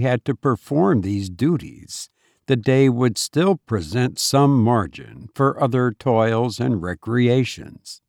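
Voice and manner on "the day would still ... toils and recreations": ordinary delivery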